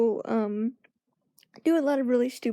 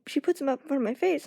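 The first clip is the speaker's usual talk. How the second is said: ordinary talk, nothing marked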